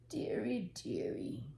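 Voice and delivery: whispered dearie, falling away; soft sigh. A narrator's reading. Lonely shoemaker on Christmas Eve, whispered sigh. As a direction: hush it like a sad old man. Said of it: "said Papa Panov".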